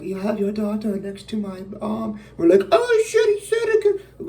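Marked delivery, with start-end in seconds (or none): 0.0-2.2 lightly put-on villain voice, pitched higher; higher, slightly altered acted voice; Rami Malek. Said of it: "when Rami Malek's like". 2.7-4.3 high, loud mock gasp of realization; exaggerated high-pitched shock; mock shocked outburst. Mocks audience's shocked reaction.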